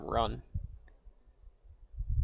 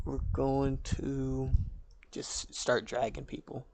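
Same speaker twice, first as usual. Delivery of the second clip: ordinary talk, nothing marked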